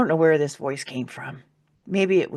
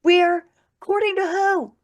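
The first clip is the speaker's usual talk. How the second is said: much higher and louder, demanding answers; angry outburst; 9 semitones higher, 3 dB louder than usual. Retold or imitated. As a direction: rage at the unseen voice. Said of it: "And then I got mad".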